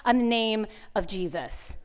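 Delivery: normal talking voice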